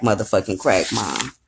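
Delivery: light mock of the husband's complaint; low muttered imitation of Clint. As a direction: mutter it down low, half-hearted. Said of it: "He goes in the room and was like".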